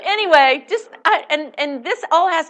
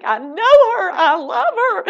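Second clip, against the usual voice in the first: mock fan voice; 9 semitones higher, 8 dB louder than usual. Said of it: "and they think".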